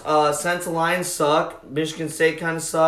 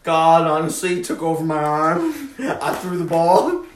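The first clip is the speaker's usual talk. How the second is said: put-on Southern accent. Host playing a quarterback in interviews, Southern accent. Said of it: "He's like".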